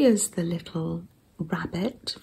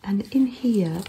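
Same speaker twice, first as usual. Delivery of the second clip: ASMR whisper. Real, not acted. Showing a handmade zine to viewers in a gentle whisper. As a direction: hush every word for the listener. ASMR journal flip-through.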